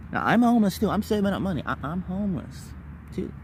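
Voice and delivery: ordinary talk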